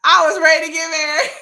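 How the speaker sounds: shouting through laughing tears; laugh-crying shout. Real, not acted. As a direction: yell it half laughing, half crying.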